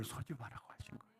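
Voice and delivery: quick hushed whisper. Acted. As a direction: lean in and whisper it fast. Whisper for emphasis in sermon. (빠르게 속삭이듯)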